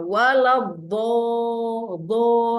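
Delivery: very slow "Waladdo" with a heavy dod; drawn-out recitation. Shown as a demonstration. Teacher reciting slowly to model the correct dod. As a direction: draw out "Waladdo-, do" from the tongue's side. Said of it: "jangan baca walado".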